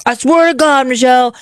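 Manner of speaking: high, mock-furious scolding; angry husband. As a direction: imitate the husband venting, slowing on the last line. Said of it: "he was so pissed at me".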